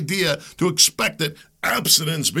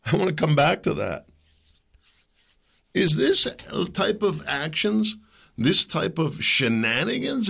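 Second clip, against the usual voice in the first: normal talking voice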